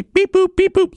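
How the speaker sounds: high, loud robot beeps; robot beeping. Pretend. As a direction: chirp it like a tiny angry robot. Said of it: "a pair of cold metallic hands".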